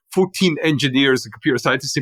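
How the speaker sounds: ordinary talk, nothing marked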